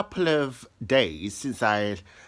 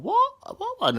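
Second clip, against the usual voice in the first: squeaky, much higher disbelieving what; shocked kids; 4 dB louder, much slower than usual. Retold or imitated. Kids hearing he left, squeaky high surprise. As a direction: shoot the pitch way up in mock shock. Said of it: "They're going".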